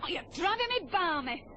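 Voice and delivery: ordinary delivery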